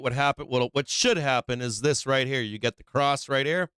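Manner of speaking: normal talking voice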